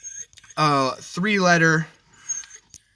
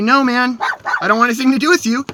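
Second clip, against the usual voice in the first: louder, rushed firm refusal; retold loud refusal; 8 semitones higher, 8 dB louder, faster than usual. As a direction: snap back at the neighbor fast. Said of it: "I say".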